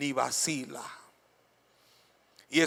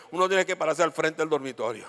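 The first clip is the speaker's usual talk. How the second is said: ordinary talk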